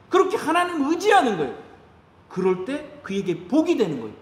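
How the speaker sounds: usual speaking voice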